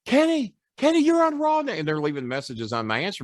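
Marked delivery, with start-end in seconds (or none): 0.1-1.7 much higher, eager calling out; excited callers. Retold or imitated. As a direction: squeal it like thrilled friends.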